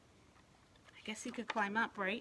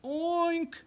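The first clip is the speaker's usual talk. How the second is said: high loud oink; loud pig oink; 9 semitones higher, 8 dB louder than usual. Acted. Pig voiced.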